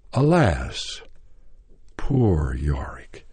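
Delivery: drawn-out recitation. Retold or imitated. Actor restarting his audition speech, drawn-out recitation. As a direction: stretch each word theatrically, with a long pause between.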